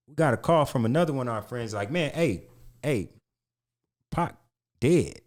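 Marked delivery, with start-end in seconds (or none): none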